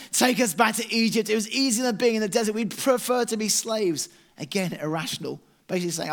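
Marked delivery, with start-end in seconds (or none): 0.1-4.1 high, loud whiny complaint; mock moaning crowd wanting Egypt; moaning Israelites. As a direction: whine like ungrateful grumblers. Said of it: "they moan and complain and say".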